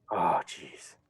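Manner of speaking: low, soft stunned mutter; a dazed creature looking over the edge, low and soft; dazed mock griffin. Mock griffin dazedly peering over the edge, low and soft. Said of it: "He goes".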